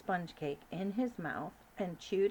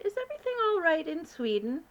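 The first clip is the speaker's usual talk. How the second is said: high, regal, louder queen voice; 9 semitones higher, 5 dB louder, faster than usual. Acted. Queen calling up to the giant, regal and high. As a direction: speak as a commanding queen.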